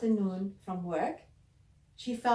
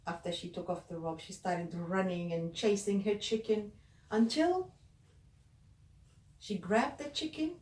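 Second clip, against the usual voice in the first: ordinary delivery, nothing marked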